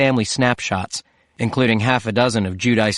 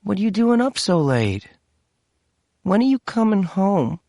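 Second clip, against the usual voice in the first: put-on small child, much higher and pleading; a young daughter missing her dad; child asking when daddy's home; little girl voice; 9 semitones higher than usual. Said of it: "his daughter asked".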